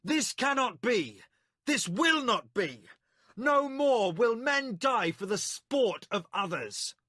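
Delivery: high, loud, slowed declaration; each line a blow of defiance; defiant shouting. A narrator's reading. Milimber shouting down the arena games, defiant. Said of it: "Milimber shouted back".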